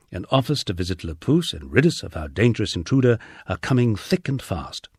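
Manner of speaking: ordinary delivery, nothing marked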